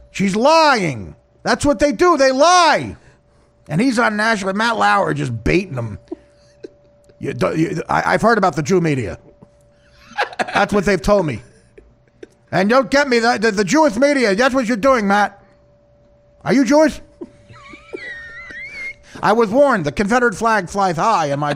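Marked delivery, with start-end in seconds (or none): 0.1-3.0 indignant husband. Retold or imitated. Voiced husband insisting. 10.5-17.0 accusing husband. Retold or imitated. Voiced husband accusing interviewer.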